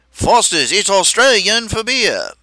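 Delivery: slow, loud Australian ad voice; Australian accent. Retold or imitated. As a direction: announce it like an Aussie beer ad. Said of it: "this Australian voice".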